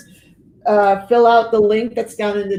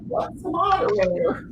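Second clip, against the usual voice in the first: mock clueless; 9 semitones higher than usual. Retells own cluelessness as newcomer.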